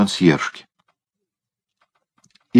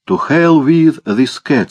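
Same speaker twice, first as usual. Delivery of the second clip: American English; 7 semitones higher, 3 dB louder, slower than usual. Acted. Second American speaks English.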